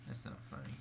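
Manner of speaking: low, breathy mock-serious aside; whispered aside. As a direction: pretend to disapprove under the breath. Mock denial after laughing.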